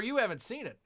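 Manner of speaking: ordinary talk, nothing marked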